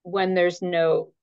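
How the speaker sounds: usual speaking voice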